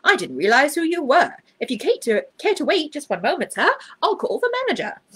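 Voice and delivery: high, eager waiter voice, rising as he goes; a startled waiter scrambling to please; a waiter jumping to attention, high and flustered. A narrator's reading. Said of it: "he exclaimed".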